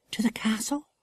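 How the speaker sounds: put-on high, soft girl's voice, puzzled; little wild girl. A narrator's reading. Tassie's voice, a young girl.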